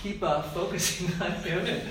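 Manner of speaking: words through a light laugh; real amused chuckle mid-sentence; laughing while talking. Real, not acted. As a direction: talk on through a giggle.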